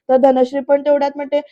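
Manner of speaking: plain reading voice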